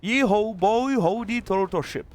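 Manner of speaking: put-on over-the-top American cowboy accent, high and loud. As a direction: yell it like a Texan at a rodeo. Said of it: "That was my American accent".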